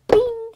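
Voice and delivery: high, loud imitated spring noise; a playful sound effect, pitched high and loud; mock bing. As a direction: make the pedal go bing with your voice.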